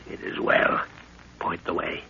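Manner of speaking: soft whisper, no voice. Acted. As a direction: whisper the order under cover of dark. Sneaking out at night.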